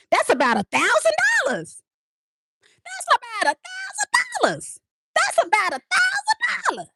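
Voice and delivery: very high, incredulous exclaim; amazed squeal. Real, not acted. Vlogger marvelling at the boyfriend's gift, high squeal. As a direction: shriek in disbelief at the price.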